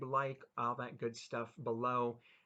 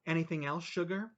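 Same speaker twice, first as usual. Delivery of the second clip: waitress voice; 5 semitones higher, 6 dB louder than usual. Acted. Waitress offering a customer more, lilting and high. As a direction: sweet-talk him like a diner waitress. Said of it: "The fat lady held the check".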